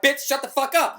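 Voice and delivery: loud, high, angry snap; angry shout. Retold or imitated. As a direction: yell at them to shut up. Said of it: "I'm like".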